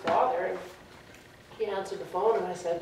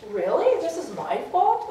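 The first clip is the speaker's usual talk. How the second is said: high, louder retold disbelief; incredulous retort; 9 semitones higher, 5 dB louder than usual. Answering the cousin's blame, high and incredulous. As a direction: throw the blame back as a question.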